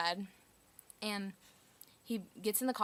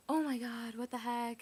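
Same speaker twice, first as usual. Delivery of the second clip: understated exclamation, far less forceful than a yell; mild retold dismay; 3 semitones higher than usual. Sister told off by the officer, mildly dismayed. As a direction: voice the sister's dismay without shouting.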